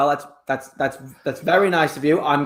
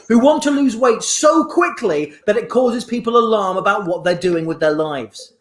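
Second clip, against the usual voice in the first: upbeat TV presenter; shopping channel host; 5 semitones higher than usual. Acted. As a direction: keep the TV host patter.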